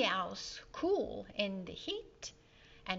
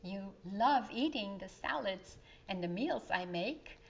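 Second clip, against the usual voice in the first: usual speaking voice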